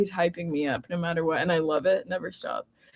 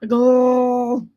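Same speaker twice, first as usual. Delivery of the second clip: laughing; 3 semitones higher, 4 dB louder than usual. Real, not acted. Laughing at own attempt.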